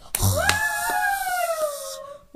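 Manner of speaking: high, loud, long gasp; dramatic gasp. Acted. Reader gasping loudly at the game-winning shot.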